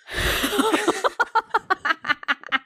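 high, long cackling laugh; helpless laughter. Real, not acted. Co-host reacting to the work story, high laughter. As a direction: burst into prolonged high giggles.

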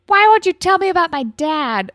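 mock a sulky kid demanding answers; bratty teen. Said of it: "being kind of shitty and bratty and being like".

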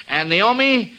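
ordinary talk, nothing marked

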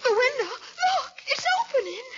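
high, alarmed gasp of the words; frightened. Acted. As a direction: point at the window in sudden fright. Leela frightened by the window.

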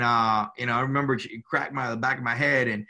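usual speaking voice

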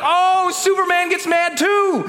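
high, slow, wide-eyed amazement; excited childlike wonder. Retold or imitated. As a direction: sound like a kid discovering it. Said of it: "I was like".

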